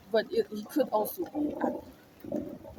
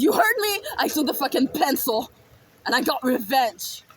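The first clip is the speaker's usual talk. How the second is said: loud, raised-voice repeat of a confession; defiant shout; 3 semitones higher, 6 dB louder than usual. Retold or imitated. Child answering shocked adults about the pencil revenge, defiantly shouting. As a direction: throw it back at them, loud and unrepentant.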